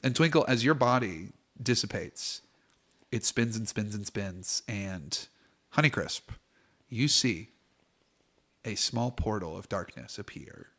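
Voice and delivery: hushed, unhurried scene setting; game master narration. Acted. GM to players, setting the death scene, hushed. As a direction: draw the players into the dark.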